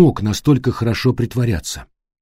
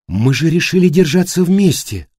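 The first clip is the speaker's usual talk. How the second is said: higher, thin anxious voice; 6 semitones higher than usual. Acted. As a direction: pitch it up thin and nervous. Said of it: "проговорила тонким голосом Эллен".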